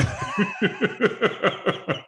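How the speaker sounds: breathless wheezing giggle; high airy laugh. Real, not acted. Laughing at his own record of fines, airy and high. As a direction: let out a thin airy laugh.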